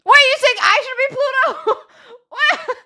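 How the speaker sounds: high, loud cracked-up squeal; mock incredulity. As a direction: act outraged disbelief through laughter. Acts out his offended reaction.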